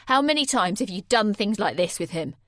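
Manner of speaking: loud demanding question; angry interrogation. Acted. Jay demanding how often she cheated, loud. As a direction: demand an answer.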